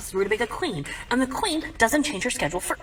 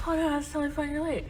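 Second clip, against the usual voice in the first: high, soft, brave-face voice; fake-okay dad; 8 semitones higher, 5 dB quieter, faster than usual. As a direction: pretend it's fine in a small high voice. Dad pretends he is fine.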